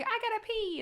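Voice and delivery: put-on high-pitched little kid plea; small mock-whiny child voice; imitating kid. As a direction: squeak it like a child in need. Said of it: "It's like".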